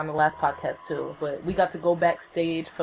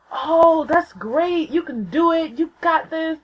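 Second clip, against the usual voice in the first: high, cooing pep talk; imitated supportive idol; encouraging; 10 semitones higher, slower than usual. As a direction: cheer them on in a high, kindly voice. Voices idol encouraging her.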